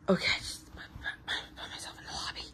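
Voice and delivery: soft voiceless whisper; lost voice. Acted. Player talking to himself in the lobby, lost voice. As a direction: mumble it in a toneless whisper. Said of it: "I don't have a voice".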